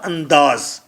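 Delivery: usual speaking voice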